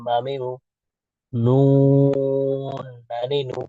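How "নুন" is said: নুন stretched very slow, long held vowel; drawn-out nun. Shown as a demonstration. Teacher showing kids the letter, drawn-out slow নুন. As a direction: hold নুন out slowly like a chant. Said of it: "drawn-out letter demo".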